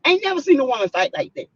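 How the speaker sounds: ordinary talk, nothing marked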